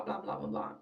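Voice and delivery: normal talking voice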